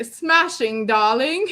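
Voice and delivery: put-on playful British accent, r dropped in "darling"; posh British accent. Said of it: "I heard it in the accent".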